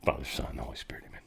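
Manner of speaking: unvoiced whisper of the sign of the cross; whispered prayer. Shown as a demonstration. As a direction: breathe it like a prayer in church. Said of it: "Like I said".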